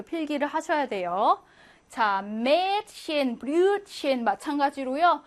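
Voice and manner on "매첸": 매첸 with a drawn-out long e; 매첸, long e, slow; long vowel. Shown as a demonstration. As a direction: stretch the vowel of 매첸 slowly. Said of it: "브류첸 길게 발음하고요".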